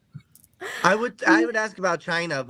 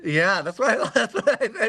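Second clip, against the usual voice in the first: usual speaking voice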